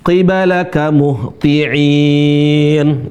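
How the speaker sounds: "qibalaka muhti'in" stretched syllable by syllable; very slow. Shown as a demonstration. Teacher slowing "qibalaka muhti'in" right down for students. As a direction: spell it out slowly for learners.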